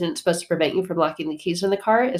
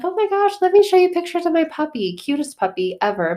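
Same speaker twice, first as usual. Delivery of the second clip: imitating a gushing lady, much higher and louder; 11 semitones higher, 5 dB louder than usual. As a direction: gush over your puppy in a high voice. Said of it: "She's like".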